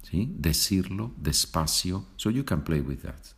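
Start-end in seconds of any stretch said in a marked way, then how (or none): 0.4-2.0 decirlo despacio with a plain S for each C; Latin American pronunciation. Shown as a demonstration. Teacher repeats for learners, S sound in decirlo despacio. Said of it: "with pronunciation from Latin America".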